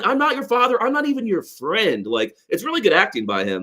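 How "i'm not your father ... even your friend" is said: high-pitched imitation of a movie line; Albert Brooks. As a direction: quote the line in a much higher, put-on voice. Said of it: "I love he delivers that line".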